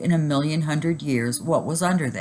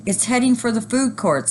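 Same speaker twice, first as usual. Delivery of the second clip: alarmed shout; 7 semitones higher than usual. A narrator's reading. Shopper warning the food court, high shout. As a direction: call out the danger. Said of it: "somebody shouted".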